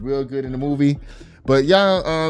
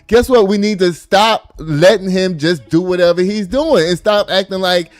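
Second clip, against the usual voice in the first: loud, fired-up rant; rally the crowd, full volume; impassioned shout; 5 semitones higher, 5 dB louder than usual. Real, not acted.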